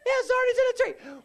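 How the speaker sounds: very high, loud frantic yell; imitated frantic soldier at full volume; panicked yelling. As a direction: shriek the report to your sergeant. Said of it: "Floyd starts yelling".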